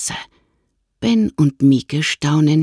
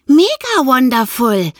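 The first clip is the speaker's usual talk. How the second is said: put-on much higher, louder girl's voice, thrilled; excited girl calling; 10 semitones higher, 4 dB louder than usual. A narrator's reading. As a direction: call it out, delighted. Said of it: "ruft Mieke".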